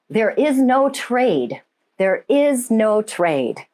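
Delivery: deliberate, spaced-out retold revelation; slow, solemn message. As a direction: deliver it very slowly, each word weighted. Retells message heard in meditation.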